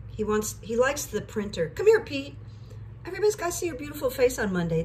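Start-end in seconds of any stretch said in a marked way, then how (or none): 1.7-4.8 high, sing-song coaxing voice; pet talk. Real, not acted. Calling Petey to show his face, high coaxing voice. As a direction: coax the cat into view, high and sweet.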